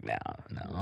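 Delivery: very soft, voiceless backing down; whispered no. Retold or imitated. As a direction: breathe it out, giving in. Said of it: "And then I was like".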